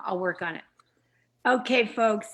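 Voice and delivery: ordinary talk, nothing marked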